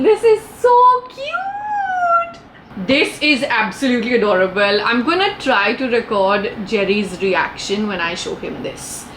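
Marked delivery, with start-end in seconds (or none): 0.0-2.4 high, slow squeal of delight; gushing. Real, not acted. Gushes over the pillow. 7.9-9.0 unvoiced whisper. Real, not acted. Whispers so Jerry won't hear.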